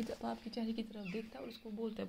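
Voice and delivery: ordinary talk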